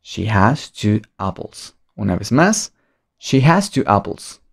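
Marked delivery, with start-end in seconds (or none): 0.0-1.8 slow, careful teacher model; slow model English. Shown as a demonstration. Teacher modelling an English sentence slowly for students. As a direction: spell it out slowly for the class. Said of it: "Cómo se dice ella tiene dos manzanas".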